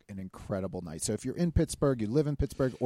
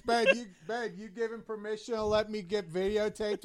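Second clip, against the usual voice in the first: loud, high call for attention; 11 semitones higher, 4 dB louder than usual. Real, not acted. Husband to wife across the room, calling out. Calls wife over during the meeting.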